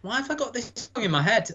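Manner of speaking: exasperated puzzlement. Retold or imitated. To the co-host, retelling her baffled thought in a high voice. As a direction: pitch it up like you can't shake the tune. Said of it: "Every time I was like".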